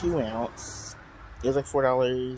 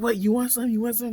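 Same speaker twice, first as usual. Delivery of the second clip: higher, taunting challenge; mock confrontational; 10 semitones higher, 3 dB louder than usual. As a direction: dare him to fight. Confrontation, they were arguing.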